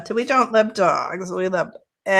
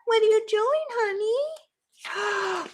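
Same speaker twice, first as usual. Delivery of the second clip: squeaky high pet voice to the cat; 13 semitones higher, slower than usual. Real, not acted. Crafter cooing to her cat in a high pet voice.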